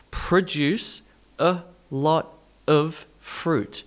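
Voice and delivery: slow, separated: pruh-DUCE uh lot uv fruit; broken-down schwas. Shown as a demonstration. Teacher shows the schwa sounds, slow separated words. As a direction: pull it apart slowly, "a" as uh and "of" as uv. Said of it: "these schwa sounds".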